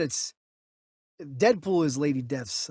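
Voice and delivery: ordinary talk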